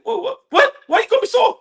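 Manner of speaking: high-pitched mock impression of the cohost; high mock imitation. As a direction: squeak it out mocking him. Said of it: "He said".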